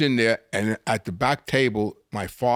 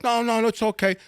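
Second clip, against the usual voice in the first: much higher, fawning quick assurance; eager owner; 11 semitones higher than usual. Retold or imitated. Club owner eagerly waving off the check, fawning. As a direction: hurry to please the boss's son.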